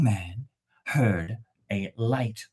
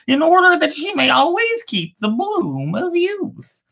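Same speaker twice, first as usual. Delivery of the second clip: other bird; 12 semitones higher than usual. Acted. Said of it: "The other replied".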